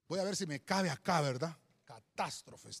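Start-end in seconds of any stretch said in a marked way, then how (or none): 1.8-2.8 low, soft "Catástrofes", stressed on TÁS; stress on second syllable. Shown as a demonstration. Pastor checks the spelling while writing, stressed second A in "Catástrofes". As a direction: say "Catástrofes" quietly, leaning on the second A. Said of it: "Lleva acento".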